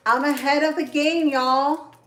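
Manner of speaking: excited boast. Real, not acted. Boasting to viewers about being early.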